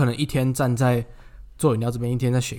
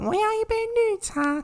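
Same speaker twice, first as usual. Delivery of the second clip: put-on high, slow cutesy baby-talk voice; slower than usual. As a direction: squeak it out like a doll. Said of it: "他声音就超级娃娃音啊". (很嗲的娃娃音)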